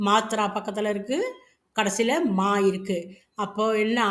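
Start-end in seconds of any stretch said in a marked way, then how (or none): none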